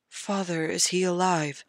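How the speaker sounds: usual speaking voice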